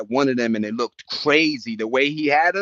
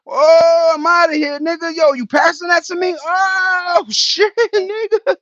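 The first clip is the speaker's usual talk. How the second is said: much higher and louder partying voice, laughing at the end; hyped clubgoer; 7 dB louder than usual. Retold or imitated. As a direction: play an excited guy at the club being passed a drink. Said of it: "and you're like this".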